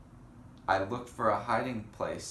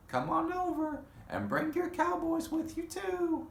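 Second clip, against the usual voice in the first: much higher, far-off auntie call; Aunt Fern calling. A narrator's reading. Aunt calling the cowboys over, high and bright.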